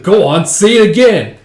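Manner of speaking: loud, high dare; acted confrontational yell; angry challenge. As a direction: dare them with a shout. Challenging the mocking men.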